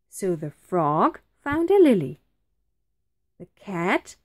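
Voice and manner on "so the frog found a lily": ordinary delivery, nothing marked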